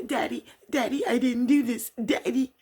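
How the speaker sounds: high, loud mock wail; panicked pleading. Daughter calling to her father during arrest, pleading. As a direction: cry out for Daddy, hammed up.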